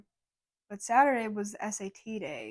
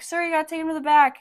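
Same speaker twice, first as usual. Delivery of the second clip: loud, higher call after him; 6 semitones higher, 8 dB louder, faster than usual. Retold or imitated. Cashier repeating the instruction to a departing customer, loud. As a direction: raise your voice so he hears. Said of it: "I'm like".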